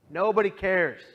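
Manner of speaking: a sudden loud cry of despair; despairing outburst. Retold or imitated. Alone after leaving the bus, thinking nobody cares, loud despairing cry. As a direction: blurt it out loud, hurt and hopeless.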